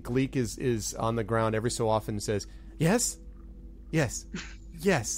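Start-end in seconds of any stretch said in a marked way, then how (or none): 2.8-5.2 high, tentative "Yes" tried out; a goblin sounding out "Yes" for the first time; goblin testing a word. Acted. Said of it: "Like he's testing out the word".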